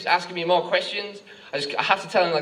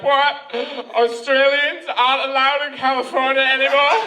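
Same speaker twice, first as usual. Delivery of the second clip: high, loud comic panic; freaked-out panic; 7 semitones higher, 4 dB louder than usual. Acted. Panicked tourist answering the policeman, freaked out. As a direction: wail it in mock alarm, rising at the end. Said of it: "I'm just freaked out".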